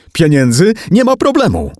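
high frantic bargaining, climbing; panicked, high-pitched outburst; screamed offer. A narrator's reading. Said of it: "wrzasnęła T J".

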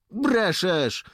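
high, loud flat-out denial; contradicting. A narrator's reading. Dońka disputes Jakub before the lieutenant, contradicting. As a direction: contradict him loudly, pitch jumping up. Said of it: "zaprzeczył Dońka".

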